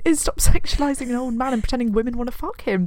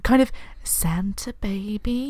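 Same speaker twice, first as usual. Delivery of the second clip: breathy sultry whisper, slow; 6 dB quieter, slower than usual. Retold or imitated. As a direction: whisper it like a seductive song line. Whispered sexy song quote.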